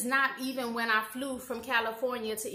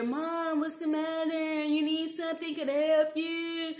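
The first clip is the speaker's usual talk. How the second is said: higher, gentle caring voice; sweet concerned daughter; 5 semitones higher than usual. Retold or imitated. Offering help to screaming mother.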